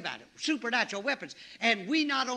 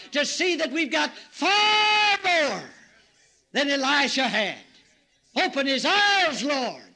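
higher, louder, slow pulpit cry; full-force preaching; 4 semitones higher, 7 dB louder, slower than usual. Real, not acted. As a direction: thunder it out to the congregation.

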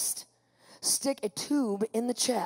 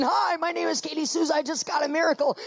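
higher, chirpy repeated introduction; excited greeting; 4 semitones higher than usual. Retold or imitated. Patient greeting the next person in, excited. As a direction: announce it brightly to a newcomer. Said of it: "I do this".

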